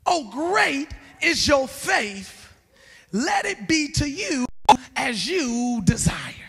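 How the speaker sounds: very slow, spaced-out scripture; solemn repetition. Acted. Preacher repeats Jesus' answer to the woman, slow solemn reading. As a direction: draw out each phrase, without raising the voice.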